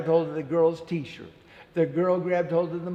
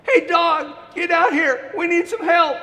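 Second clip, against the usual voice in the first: high, loud call; girl calling the dog; 11 semitones higher, 9 dB louder than usual. Acted. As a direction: shout for the dog to come. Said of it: "called the dog".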